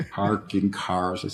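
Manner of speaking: slow General American with sounded Rs; over-careful American "parking cars", every R pronounced; normal American accent. Shown as a demonstration. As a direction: slowly demonstrate a standard American accent. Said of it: "I can say it in a normal American accent".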